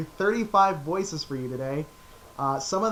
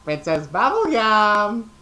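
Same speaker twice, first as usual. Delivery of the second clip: higher, louder, slow x0.6 wail; Ice King; 5 semitones higher, 7 dB louder, slower than usual. Acted. Ice King calling for the princess, slow wail. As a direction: call out like a lovesick ice wizard. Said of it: "the Ice King from Adventure Time".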